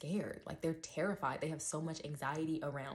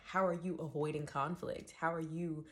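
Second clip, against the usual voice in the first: usual speaking voice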